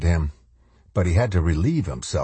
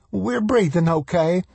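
much higher woman's voice; voiced woman; 12 semitones higher than usual. A narrator's reading. Woman answering in the shelter, high voice. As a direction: speak up high as the frightened woman.

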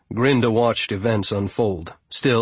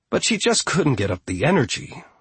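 plain reading voice

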